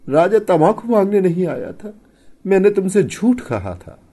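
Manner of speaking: very high, loud distraught voice; tearful confession. A narrator's reading. Wife admitting her lie to her husband, tearful. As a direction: blurt out the lie as if weeping.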